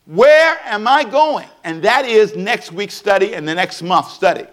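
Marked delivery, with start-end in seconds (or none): 0.1-1.5 slow, high, stressing WHERE; "Where" lifted high and held, slow; slow searching question. Shown as a demonstration. Pastor asking the congregation to pause, slow searching question. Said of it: "pause and ask yourself".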